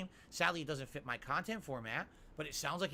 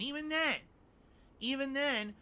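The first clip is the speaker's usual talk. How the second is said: emphatic; 10 semitones higher, 4 dB louder than usual. Real, not acted. Host raising his point.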